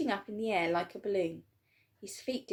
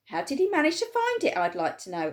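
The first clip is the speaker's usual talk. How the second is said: high, loud resentful shout; angry shout; 7 semitones higher, 6 dB louder than usual. A narrator's reading. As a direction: demand it jealously. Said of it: "a large boy shouted angrily".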